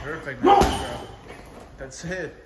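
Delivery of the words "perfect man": high, slow excited praise. Real, not acted. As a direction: cheer him on, thrilled. Praising the fighter's effort.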